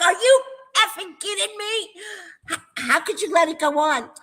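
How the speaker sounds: high-pitched, exasperated retort, retold; outraged disbelief. Grandmother aghast at daughter letting kids scream, outraged. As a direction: replay your indignant disbelief.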